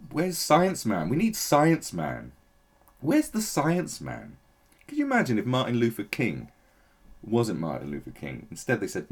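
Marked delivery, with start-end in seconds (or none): 0.1-4.4 calling out. Pretend. Calling out for a hero.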